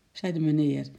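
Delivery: ordinary delivery, nothing marked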